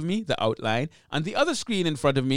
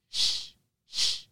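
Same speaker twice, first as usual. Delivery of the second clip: airy unvoiced sh hiss; sibilant hiss demo; 5 dB quieter than usual. Shown as a demonstration. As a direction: push out a hissy sh sound. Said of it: "you hear, like, a sh".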